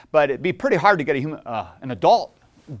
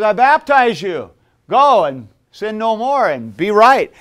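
higher, louder, slow solemn pronouncement; solemn John the Baptist; 8 semitones higher, 11 dB louder, slower than usual. Retold or imitated. John the Baptist blessing kneeling people, slow solemn pronouncement. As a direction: intone it like a prophet blessing. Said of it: "and he says".

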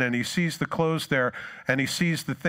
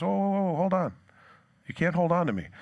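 lightly acted "hang on a second", pitched up; mock startled protest; 6 semitones higher than usual. Jesus stops Mary gripping his feet, mock startled.

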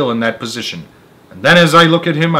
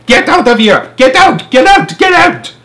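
rising, much higher and louder yells; furious shout; 13 semitones higher, 8 dB louder than usual. Retold or imitated. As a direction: keep screaming him out of the hut. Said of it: "he shouts".

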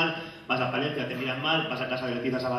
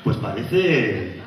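low, drawn-out come-on; mock-seductive, lower opening line; awkward seducer; 4 semitones lower, slower than usual. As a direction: stretch it out like a hesitant porn actor. Shy porn actor making a move.

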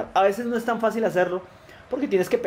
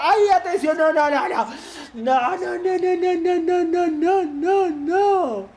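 high, frantic string of cries; real alarm, pitched way up; scared panic; 12 semitones higher, much slower than usual. Real, not acted. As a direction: panic as danger closes in on goal. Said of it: "qué miedo".

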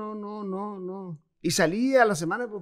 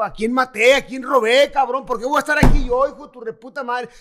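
loud, higher string of outraged questions; louder, higher retold yell of innocence; retold furious shouting; 4 semitones higher, 7 dB louder than usual. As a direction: rail against the guards as if wrongly locked up. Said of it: "le dije, decía yo".

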